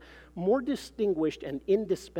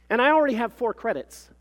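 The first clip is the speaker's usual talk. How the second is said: high, loud, self-important claim; pompous professional; 8 semitones higher, 8 dB louder than usual. A narrator's reading. Mother describes her research to the clerk, pompous and assured. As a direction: proclaim it like an impressive credential.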